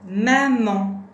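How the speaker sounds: loud, deliberate teacher's model word; syllables held evenly and loudly; word dictation. Shown as a demonstration. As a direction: project each syllable clearly for a learner.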